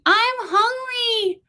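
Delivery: put-on very high, very slow child's whine; whiny toddler. A narrator's reading.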